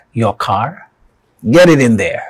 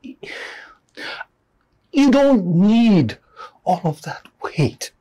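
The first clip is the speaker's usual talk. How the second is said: high, drawn-out plea; 10 semitones higher, much slower than usual. Acted. Urges listeners to drop the weight.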